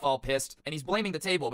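usual speaking voice

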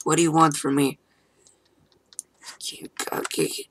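ordinary talk

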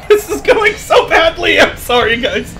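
high, loud laughing wail; real helpless high-pitched giggly shout; laughing despair. Real, not acted. Laughing despair at failing in game.

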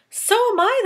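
sudden high, loud exclaim; ladybug crying out. Acted. Ladybug joining the hungry chorus, crying out. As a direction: cry it out, eager to eat. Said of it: "the ladybug cried".

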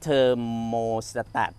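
"thermostat" said very slowly, every syllable stretched out; careful pronunciation. Shown as a demonstration. Vlogger showing viewers how he says the word, very slow and careful. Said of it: "ออกเสียงแบบนี้นะ".

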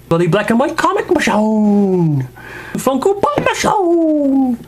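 high, drawn-out mock-grand roll-call; over-the-top announcer. Reviewer parading figure collection, hammed-up announcing. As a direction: swoop up and hold each name like a hype man.

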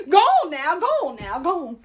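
high cackling laugh; loud cackle. Acted. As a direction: cackle then shoo them off. Character cackles.

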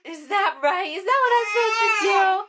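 high quick coo; baby talk. Acted. Mom cooing high-pitched to her baby.

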